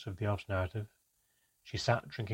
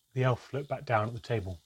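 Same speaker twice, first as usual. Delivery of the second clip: ordinary delivery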